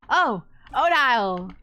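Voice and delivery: slow, loud startled cry. Acted. Siffrin startled by Odile at the door, loud and drawn out. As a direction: jump at being caught.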